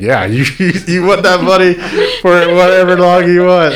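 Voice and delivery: high-pitched, laughing go-ahead; retold eager permission; laughing indulgence. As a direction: grant it eagerly through a laugh. Volunteers indulging girl, said through laugh.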